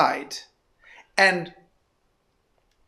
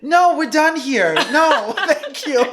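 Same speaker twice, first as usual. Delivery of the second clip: higher, slow, laughing brush-off; firm laughing refusal; 6 semitones higher, 3 dB louder than usual. Retold or imitated. Friend refusing the sales associate, laughing firm goodbye. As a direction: shut the sale down politely but firmly. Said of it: "And she's like".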